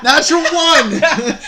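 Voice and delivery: real, very loud, high, drawn-out cry with laughter; gleeful shout. Real, not acted. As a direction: belt it out slowly, delighted by the bad roll. Game master announces a fumbled roll.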